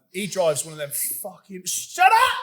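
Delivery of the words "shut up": shouts it out much higher and louder; a yelp to cut his mate off; sudden shout. Real, not acted. Shouting at his co-host to shut up.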